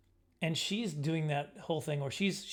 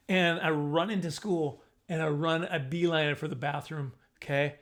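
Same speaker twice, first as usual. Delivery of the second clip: ordinary talk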